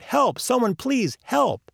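slow, high-pitched plea for help; calling out. A narrator's reading. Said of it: "continues calling out".

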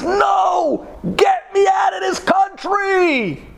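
high, drawn-out desperate shout; imitated screaming. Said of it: "he wouldn't stop screaming".

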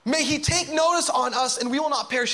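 high, forceful rousing cry; commanding shout easing into urgent appeal; urgent captain. Acted. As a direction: rouse the sleeper as the storm rages. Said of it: "The captain went down to him and said".

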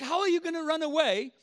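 high, quick imitation of a baffled parent; incredulous dad. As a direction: fire the question like an exasperated dad. Dad challenges runaway son.

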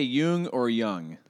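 Jung first as "Yoong", then as "Yung"; two accepted pronunciations. Shown as a demonstration. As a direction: give Jung both ways with a Y, slowly. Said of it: "People say Jung or Jung".

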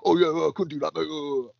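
a hint of a flustered bloke; imitating the bloke. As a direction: fumble the excuse like a caught-out lad. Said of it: "I said".

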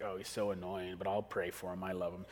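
grudging sarcasm. Retold or imitated. Praying for the jerk boss, grudging and sarcastic. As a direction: say it through clenched sarcasm. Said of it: "you're just like".

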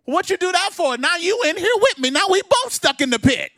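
high, loud incredulous complaint; a hammed-up outraged man in a pit; mock indignant guy. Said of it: "one guy will say".

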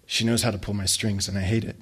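ordinary delivery

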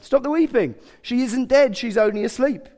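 high, loud and authoritative; loud command. Acted. Jesus to the wailing mourners, loudly commanding.

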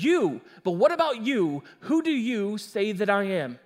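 pointed stress on "you"; emphatic. Retold or imitated. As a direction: hit each "you" with a big pitch swing. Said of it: "this is very emphatic".

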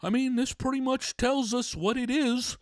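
high put-on doctor voice; mock physician. Physician telling him the results, silly high voice. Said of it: "the physician was like".

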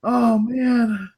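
tears of laughter in the voice; crying with laughter. Real, not acted. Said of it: "I'm crying here".

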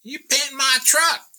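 loud, high-pitched Southern lady; Southern accent. Retold or imitated. Truck owner accuses a street painter, loud Southern accent. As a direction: squawk it in a mock Southern accent. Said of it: "she has a southern accent".

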